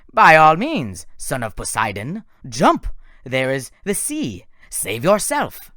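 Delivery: loud, sneering taunt slowing at the end; mocking villain. Doctor Thorne taunting Percy to jump into the sea, sneering. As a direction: dare him to jump, savouring it.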